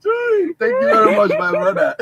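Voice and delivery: high squeal of laughter, drawn out; helpless high-pitched laughing thanks. Real, not acted. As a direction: squeeze the thanks out through laughter. Cracking up with laughter.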